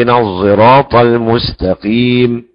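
slow chant, the sad of "الصراط" voiced as an emphatic z; ishmam recitation. Shown as a demonstration. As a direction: recite "الصراط" as az-ziraat with long vowels. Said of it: "يقرأ بإشمام الصاد زايا". (ترتيل بإشمام صاد "الصراط" زايًا)

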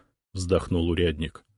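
usual speaking voice

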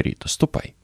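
softly urging her to go; quiet whispered nudge; hushed. A narrator's reading. Старшина softly sends Rita off.

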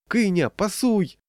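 high, slow, drawn-out call; pleading boy. A narrator's reading. Boy begging teammate for the ball, pleading call. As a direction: beg like a thin-voiced boy. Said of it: "умолял тоненький интеллигентный мальчик".

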